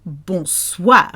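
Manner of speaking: exaggerated bonsoir. Shown as a demonstration. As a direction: overdo "Bonsoir" as bon-swahr, at speaking pitch. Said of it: "don't have to say it like that".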